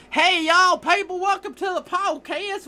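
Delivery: mock Southern drawl welcoming the listeners; funny Southern accent. Podcaster mocks a Southern host with a hammed-up Southern accent. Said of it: "I'll start off with a funny accent".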